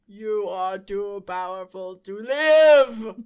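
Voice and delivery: loud slow robot line; booming, deliberate robot. Pretend. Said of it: "And the robot says".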